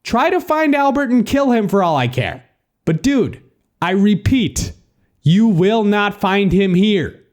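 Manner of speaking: high-pitched emphatic telling-off; annoyed. A narrator's reading. New resident tells the caller it's the wrong number, annoyed. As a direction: lay it out firmly, irritated. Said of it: "am annoyed that you keep calling my number".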